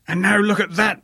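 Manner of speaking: high, mock-yelled outrage; half-hearted yell at an empty car, high. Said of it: "I yelled to an empty car".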